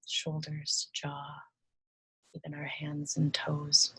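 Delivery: soft, unhurried meditation voice with long pauses; guided meditation. Acted. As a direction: ease the listener into relaxing, hushed and gentle.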